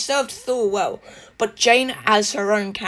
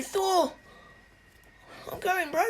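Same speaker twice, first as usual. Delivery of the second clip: much higher, lighthearted goodbye voice; Korg's farewell; 10 semitones higher than usual. Retold or imitated. Korg saying goodbye to his best friend Thor, much higher and breezy. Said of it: "he was going".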